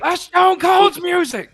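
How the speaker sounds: thrilled recognition shout; excited shout. Real, not acted. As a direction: yell it out as you recognize the entrance theme. Recognizes wrestling entrance music.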